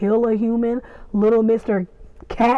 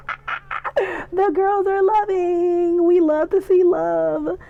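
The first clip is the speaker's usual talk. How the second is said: high, slow squealing delight; excited squeal; 8 semitones higher, slower than usual. Retold or imitated. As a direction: squeal it with joy. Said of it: "And I screamed".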